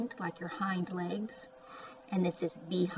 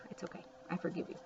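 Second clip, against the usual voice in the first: gentle reassurance; 11 dB quieter, much faster than usual. Real, not acted.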